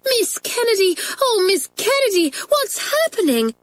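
high-pitched, hammed-up schoolgirl alarm; pretend fright. A narrator's reading. Pat pretends to be frightened to her teacher, mock alarm. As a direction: act scared, squealing up high for the teacher. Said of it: "pretending to be frightened".

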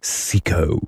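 mildly steely summons; understated steel. A narrator's reading. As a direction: call the name with cool control. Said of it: "he said in his most steely voice".

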